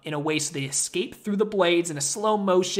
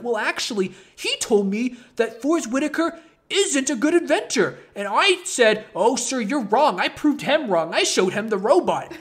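higher, louder proud goofball voice; hammed-up higher voice of a clueless proud helper; smug Edison voice; 6 semitones higher, 4 dB louder than usual. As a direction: brag like a pleased idiot who missed the point. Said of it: "And Edison's like".

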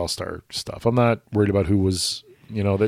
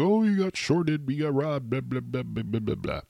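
mocking griping; 4 semitones higher, 6 dB quieter than usual. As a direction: imitate moaning writers, starting high and trailing softer. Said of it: "everybody griped and moaned and complained".

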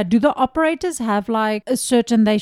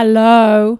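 much louder, drawn-out hello; louder operator hello; 9 dB louder than usual. Acted. 911 operator to an unresponsive caller, calling out louder. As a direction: raise the voice to reach a silent caller. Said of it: "And they talk louder".

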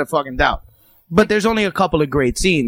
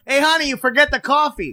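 higher, teasing call to the stewardess; joking to stewardess; 11 semitones higher than usual. Retold or imitated. A passenger teasing the stewardess, higher mock call. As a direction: sing it out cheekily. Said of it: "a stewardess runs up and he goes".

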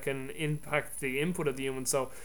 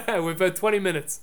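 amused reply through a laugh, higher; 5 semitones higher, 7 dB louder than usual. Real, not acted. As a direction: answer while still laughing, pitch lifted. Amused reply to joking dodge.

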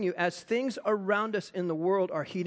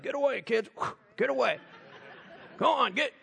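disciples shooing; 4 semitones higher than usual. Acted. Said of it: "the disciples like".

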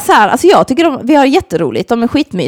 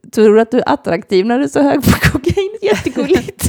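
laughing; 5 semitones higher than usual. Retold or imitated. Mocking high guy teasing.

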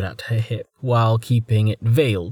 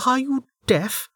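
higher, scornful voice; contemptuous woman; 14 semitones higher than usual. Acted. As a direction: sneer the question. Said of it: "Velika asked".

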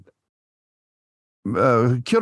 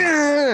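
mock grunt; 10 semitones higher than usual. Voicing the pusher's grunt.

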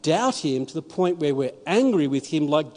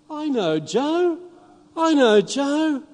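higher and slow, amazed; excited recognition; 8 semitones higher, slower than usual. Retold or imitated. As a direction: retell his delighted surprise, drawn out. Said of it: "And he goes".

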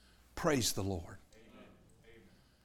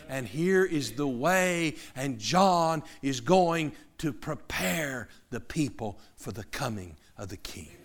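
ordinary talk

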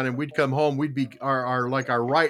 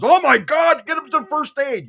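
alarmed attendant; 8 semitones higher, 6 dB louder than usual. Retold or imitated. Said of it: "comes up and goes".

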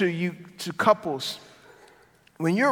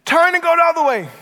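higher, louder, quick harsh order; harsh barked command; 10 semitones higher, 8 dB louder, faster than usual. Retold or imitated. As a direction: snap it like an impatient GPS. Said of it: "It's not as harsh as".

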